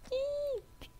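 small high-pitched eek; tiny playful squeal. Pretend. Squeals while playing game.

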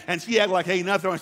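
ordinary delivery

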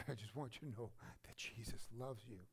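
softly voiced pastor, tender; gentle quote. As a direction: pass on the message softly. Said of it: "He says".

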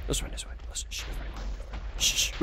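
very soft, secretive hush; sneaky whisper. Real, not acted. Gamer sneaking this way, whispering. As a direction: barely voice it, as if sneaking. Sneaking past enemies in game.

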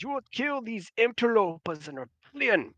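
high-pitched indignant command; offended governor. Acted. Usurping governor orders loyal soldiers to kill, offended command. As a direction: demand their deaths in outrage. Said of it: "the governor be offended".

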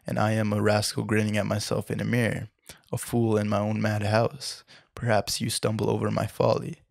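usual speaking voice

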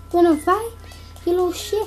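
usual speaking voice

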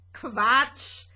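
loud, amused dismissal; laughing scoff. A narrator's reading. Green spaceship brushing off Rosetta's question, amused scoff. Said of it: "lachte das kleine grüne Raumschiff".

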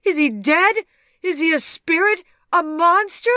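high, loud frantic questions; hysterical, rising alarm; horrified cry. A narrator's reading. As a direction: fire off each question in a shrieking panic. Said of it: "she cried".

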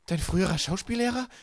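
high, loud question of disbelief; aghast. A narrator's reading. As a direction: ask it aghast, pitched right up. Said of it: "fragte Violet entgeistert". (entgeistert, hoch und ungläubig)

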